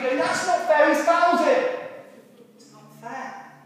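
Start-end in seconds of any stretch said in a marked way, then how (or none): 0.2-1.8 louder and higher football-fan shout; yelling at the ref. Retold or imitated. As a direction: bellow at the TV referee. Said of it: "yell at the referee".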